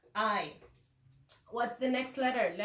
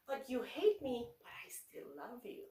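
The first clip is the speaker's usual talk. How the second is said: tender reassurance; 7 dB quieter than usual. Retold or imitated. Teacher tells angry pupil she still cares, tender and hushed. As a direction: reassure gently, sinking almost to a murmur.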